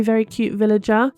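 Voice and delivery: ordinary delivery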